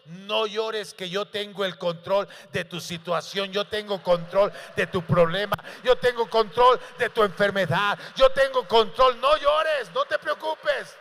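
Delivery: preacher speaking as Jesus to the grieving, rising to a loud preaching climax; preaching at full force. Acted. Preacher voicing Jesus's comfort to the congregation, preached at full force.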